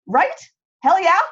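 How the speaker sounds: ordinary talk, nothing marked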